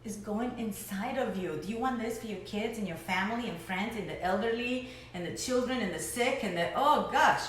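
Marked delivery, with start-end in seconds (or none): none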